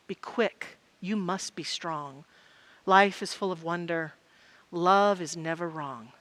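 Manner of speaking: low, soft, measured poetry reading; recited lyrics. Acted. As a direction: recite it quietly, pausing after each phrase. Recites song lyrics as a poem.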